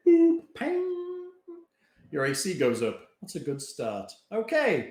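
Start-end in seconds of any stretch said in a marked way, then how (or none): 0.1-1.3 sound effect. Pretend.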